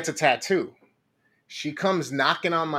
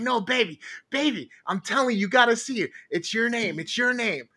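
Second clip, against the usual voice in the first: put-on pleading girlfriend; 7 semitones higher than usual. Girlfriend pleading, voiced higher.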